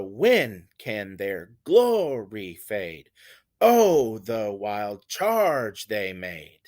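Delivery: very slow, long strong "When", "glory", "Oh", "charge"; exaggerated dactylic beat. Shown as a demonstration. Teacher making students hear the dactyl, exaggerated dactylic beat. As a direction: stretch each first beat, "Oh" and "charge", two light syllables after. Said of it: "you can hear that".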